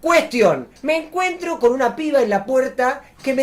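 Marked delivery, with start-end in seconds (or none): none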